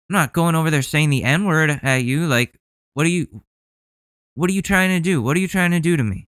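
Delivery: ordinary delivery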